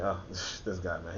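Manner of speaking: usual speaking voice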